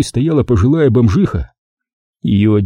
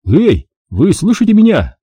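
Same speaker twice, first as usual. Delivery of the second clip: louder urgent call, pitched up; calling to rouse her; 9 semitones higher, 3 dB louder than usual. A narrator's reading. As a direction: shake her awake with the voice.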